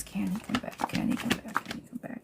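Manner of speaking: fading unvoiced whisper; whispered question repeated to the deck, fading away. Real, not acted. Whispering question over tarot cards.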